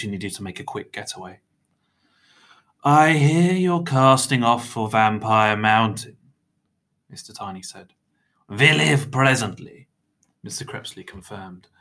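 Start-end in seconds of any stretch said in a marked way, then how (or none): 2.8-6.2 higher, louder, drawn-out character voice; slow, grand conversational opener; lightly voiced, self-satisfied meddler; meddling little man. Mister Tiny's voice in story. 8.5-9.9 higher, slow, formal vampire voice; lightly voiced stern vampire; vampire mentor. Vampire's guarded reply in story.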